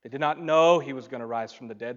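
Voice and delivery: ordinary delivery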